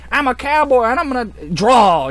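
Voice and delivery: high, loud gunslinger boast; mock cowboy. Voicing a cowboy mech pilot, hammed-up gunslinger. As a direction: play it like a Western showdown.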